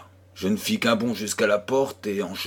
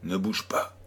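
hushed warning; 2 semitones lower, 5 dB quieter than usual. A narrator's reading. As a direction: murmur it so nobody outside hears. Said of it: "Angèle me souffla".

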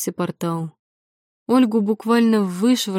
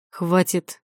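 commanding threat. Acted. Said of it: "властного голоса с нотками угрозы".

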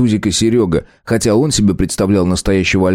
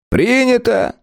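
much higher, clipped ruling; curt; slower than usual. A narrator's reading. Philipp Petrovich dismisses the deputy's protest, curt. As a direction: cut him off with finality. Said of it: "отрезал Филипп Петрович".

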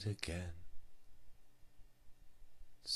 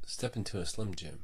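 ordinary talk

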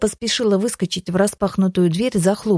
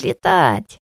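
low gleeful shout; 5 semitones lower than usual. A narrator's reading. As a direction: drop down and bellow it. Dwarf clapping, chanting.

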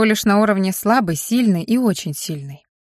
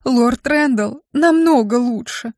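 a hint of a whimpering, higher imp voice; whining imp; 4 semitones higher, slower than usual. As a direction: whine it like a small sulky imp.

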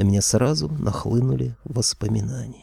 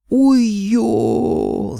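high, loud howl of pain; sudden yell; 12 semitones higher, 6 dB louder than usual. A narrator's reading. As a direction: yelp out long and high as if scalded. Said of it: "заорал вдруг Аристофан".

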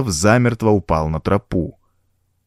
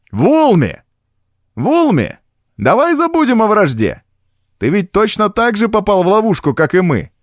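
high-pitched, raised-voice appeal; calling out; 11 semitones higher than usual. A narrator's reading. Garston calls to his enemy proposing truce, calling out. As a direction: call up to someone out of sight. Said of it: "И он позвал".

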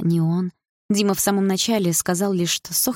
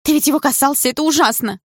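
frightened; 9 semitones higher, 5 dB louder than usual. Acted. Frightened girlfriend protests.

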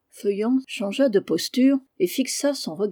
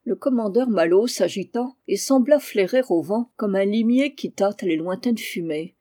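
ordinary delivery, nothing marked

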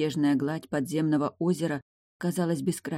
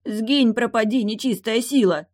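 loud, raised-pitch bark of a scared lad; barked banishing; 7 semitones higher, 5 dB louder than usual. A narrator's reading. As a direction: bark it like warding off evil. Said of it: "пятясь рявкнул Станил".

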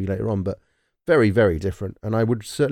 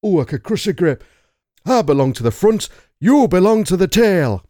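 put-on high, emphatic northern English accent, building louder; Tilda Swinton; 8 semitones higher, 5 dB louder than usual. Demonstrating the film role's accent for co-hosts, in a raised northern English voice. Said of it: "now I'm gonna have to get into the role now".

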